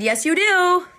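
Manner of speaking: loud, high, drawn-out insistence; insistent retort. Retold or imitated. Coffee lover overruling friend before flight attendant, loudly insistent. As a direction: overrule them playfully at top voice.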